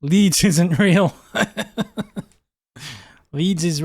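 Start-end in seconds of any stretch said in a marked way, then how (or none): 0.0-1.6 slow, loud line broken by laughter; laughing. Real, not acted. Gamer joking to his viewers that Leeds isn't real, laughing. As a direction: laugh through the joke.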